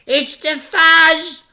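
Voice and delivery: high, loud, slow crook's alarm; yell. Acted. Crook Fingers spots the police car, a loud high yell. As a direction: shout the warning. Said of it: "yelled Fingers".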